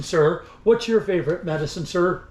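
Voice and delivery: ordinary talk, nothing marked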